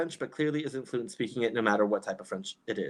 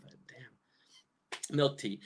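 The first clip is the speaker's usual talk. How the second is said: ordinary talk, nothing marked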